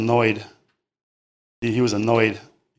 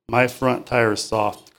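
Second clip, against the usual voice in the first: ordinary delivery, nothing marked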